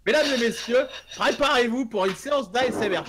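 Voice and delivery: loud, high showman announcement; hammy stage announcer. Pretend. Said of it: "se faire un peu d'A S M R".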